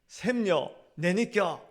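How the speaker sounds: punchline in Gyeongsang dialect. Retold or imitated. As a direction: Judas asking in broad Gyeongsang dialect. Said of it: "경상도 사투리로".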